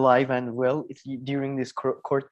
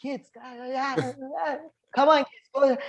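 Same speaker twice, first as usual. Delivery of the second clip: raised, higher call across the room; 8 semitones higher than usual. Real, not acted. Calling out to the kids.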